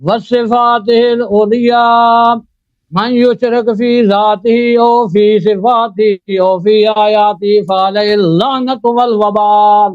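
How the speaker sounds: slow, measured, chanted khutba recitation; formal Arabic sermon opening. Acted. Debater opens his turn with a slow chanted khutba. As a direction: intone the opening praise slowly like a preacher.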